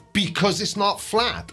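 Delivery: ordinary delivery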